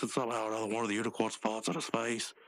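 ordinary talk

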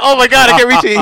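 high, loud imitation of panicking; mock panic. Little guy trying to reach the lock, mock-panicked. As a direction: blurt it out like someone trapped.

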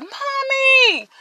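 high, frightened calls through the house; a small child searching room to room, calling out; child calling for mom. Retold or imitated. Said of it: "I'd be like".

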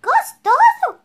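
very high, loud shocked yelp; outraged exclamation. Acted. Mouse yelps back at the gruffalo in shock. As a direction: fling the word back in disbelief. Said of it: "exclamou o ratinho".

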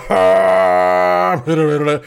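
loud cartoon puppet cackle; Blob laugh. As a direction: cackle like a clay monster. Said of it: "that was a Blob laugh".

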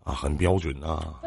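ordinary talk

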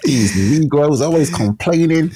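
a hammed-up Deep South accent. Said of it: "Deep South accent is, like, pretty good".

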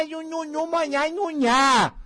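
high mocking baby whimper; babyish whine. Mocking people who whine like babies. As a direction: whine like a spoiled child.

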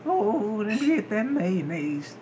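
slow, put-on southern drawl; bluegrass singer twang. Playing a southern customer after being teased, slow bluegrass twang. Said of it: "You just sound like a bluegrass singer".

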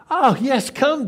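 loud, high, eager invitation; Jesus calling. Acted. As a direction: call him over with hammed-up enthusiasm. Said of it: "Jesus said".

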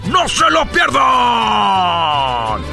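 ringmaster. Acted. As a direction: hype the crowd like a ringmaster. Announcing fiesta acts.

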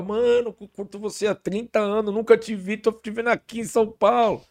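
high-pitched, amazed fan greeting; imitated fan, voice pitched well up; excited fan. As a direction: gush like a fan meeting an idol. Voices a visiting fan meeting him.

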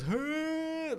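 high, loud animalistic call; animal grunt. Retold or imitated. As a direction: bark it like a creature. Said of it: "Everyone else goes".

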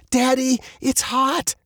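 high-pitched panicked shriek. A narrator's reading. Girl in overheating car to dad, high panicked shriek. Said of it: "Veronica shrieked".

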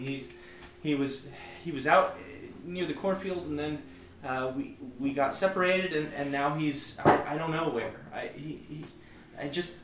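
high, stammering scared voice; frightened young woman. Acted. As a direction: stammer out fear. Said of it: "the scared, shaky voice".

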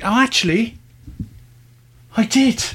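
high, surprised realisation; sudden recollection. Real, not acted. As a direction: catch yourself remembering, pitch jumping up. Suddenly remembers the embarrassing story.

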